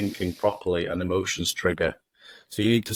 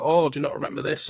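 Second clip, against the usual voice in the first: higher, anxious voicing of a nagging thought; worried inner voice; 6 semitones higher, much faster than usual. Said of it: "normally worried voice".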